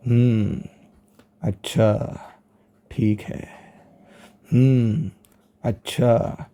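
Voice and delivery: put-on tired girlfriend on the phone, bored and dismissive; bored disinterest. Shown as a demonstration. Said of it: "यानी ये आ रहा है lack of interest".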